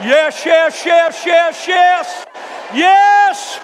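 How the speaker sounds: rising joyful shouts of yes; exultant shouting. Real, not acted. Preacher exulting at healing.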